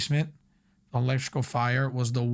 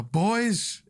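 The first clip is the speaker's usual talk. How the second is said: put-on Boston accent; 7 semitones higher than usual. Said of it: "he has that, like, Boston accent".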